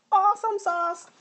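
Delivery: high, loud held-out singsong; excited high-pitched singsong; playful hype. Real, not acted. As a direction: sing it out high to hype the show. Hyping the coming broadcast.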